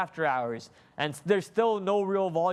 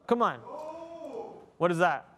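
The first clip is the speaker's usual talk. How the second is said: normal talking voice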